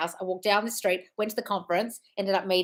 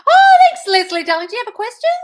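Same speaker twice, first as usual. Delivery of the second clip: much higher and louder, squealing with delight; delighted thanks; 13 semitones higher, 13 dB louder than usual. Real, not acted. Coach squealing thanks to Leslie for a super chat. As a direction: gush over the surprise gift.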